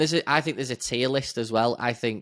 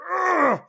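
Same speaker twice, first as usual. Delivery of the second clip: frustrated groan; 8 dB louder than usual. Retold or imitated. Frustrated at late indicator.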